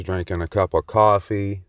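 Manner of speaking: ordinary talk, nothing marked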